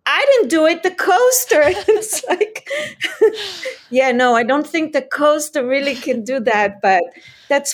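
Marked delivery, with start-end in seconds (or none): 0.1-1.6 put-on child blaming. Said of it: "He said". 1.6-3.9 laughing through the words, pitched up; can't hold back the laughter. Real, not acted. Laughing at a kid blaming a coaster. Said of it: "laughs at the story".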